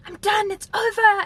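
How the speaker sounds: high, loud, drawn-out mock yell; angry outburst. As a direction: act out a fed-up man yelling. Guy shouting mid-fight in retold quarrel.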